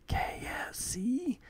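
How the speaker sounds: hushed, breathless little whisper; whispered gasp of greed; awed whisper. Acted. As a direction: breathe the name in wonder. Larfleeze reacts in the comic.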